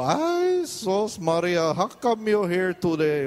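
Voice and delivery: high, loud delighted cry that slows on "sos Maria"; doctor's greeting. Retold or imitated. The Filipino doctor greeting his patient at urgent care, high delighted cry. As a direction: greet the patient like a thrilled old friend. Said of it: "he go".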